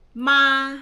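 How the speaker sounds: "Ma" held high and level, loud; tone demo. Shown as a demonstration. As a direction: sing out "Ma" on one high, steady note. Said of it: "There are four kinds of ma". (mataas at pantay na tono ng "Ma")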